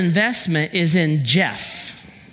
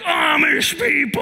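mock hateful outburst; 10 semitones higher than usual. Pastor acting out hating people in his anger, mock furious shout. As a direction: rail at them in exaggerated fury. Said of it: "then I hated people".

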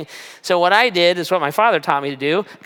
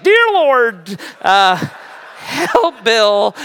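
much higher and louder, strained through sudden pain; pained prayer; 6 semitones higher, 4 dB louder, much slower than usual. Real, not acted. Pastor prays with the tech as his finger moves, in sudden pain. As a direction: blurt a prayer as the finger gets yanked. Praying while finger is moved.

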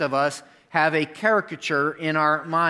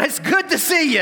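much higher, hammed-up sing-song welcome; overdone fake cheer; 12 semitones higher, 4 dB louder than usual. Pastor mocking his own false welcome, hammed-up cheer. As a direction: oversell the greeting like an obvious lie. Replays his lie, exaggerated.

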